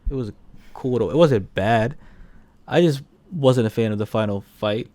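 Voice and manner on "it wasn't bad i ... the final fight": ordinary delivery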